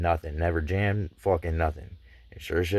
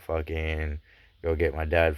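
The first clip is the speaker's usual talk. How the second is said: ordinary talk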